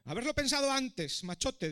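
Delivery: high, loud taunt. A narrator's reading. Dragon answering the prince's protest, taunting. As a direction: throw it back mockingly.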